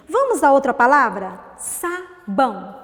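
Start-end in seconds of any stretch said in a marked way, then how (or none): 1.6-2.7 SABÃO slowly split as "sa... bão"; syllable by syllable. Shown as a demonstration. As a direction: leave a gap inside Sabão. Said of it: "Quantas sílabas?". (Sabão separado em sílabas)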